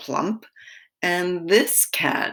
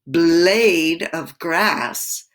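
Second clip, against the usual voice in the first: slow; 5 dB louder, much slower than usual. Shown as a demonstration.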